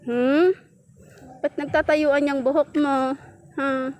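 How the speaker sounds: usual speaking voice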